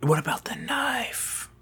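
conspiratorial whisper. Pretend. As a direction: hush it like a secret. Whispered question about evidence.

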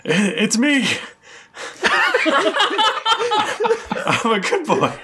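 high, giddy dog voice breaking into laughter; a pug dying to be called a good boy; eager pug. Acted. Lead pug insisting to the party he's a good boy, high and giddy. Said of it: "the lead pug goes".

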